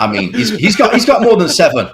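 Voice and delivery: laughing. Real, not acted. Replying to co-host's joke, laughing loudly. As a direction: still laughing while answering.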